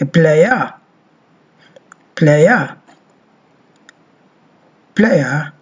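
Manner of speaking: playa said as pleja, then plaja; model word drill. Shown as a demonstration. Teacher repeats playa for students. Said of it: "as we say aya".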